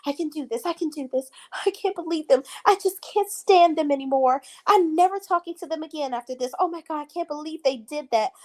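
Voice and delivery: high, whiny mock pep talk; imitating a tearful castmate's self-talk; mocking Danielle. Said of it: "because she's like".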